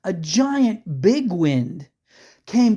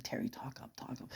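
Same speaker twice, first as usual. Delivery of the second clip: whisper; 4 semitones lower, 21 dB quieter than usual. Retold or imitated. Urging nervous Terry at graveside.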